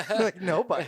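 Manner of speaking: high laughing burst. Real, not acted. Veteran laughing that no Iraqi would volunteer.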